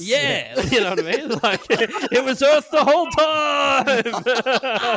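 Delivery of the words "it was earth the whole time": high, loud, drawn-out movie cry; hammed-up shout of horrified realisation; mock anguished shout. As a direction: wail it like a melodramatic film ending. Planet of the Apes parody outcry.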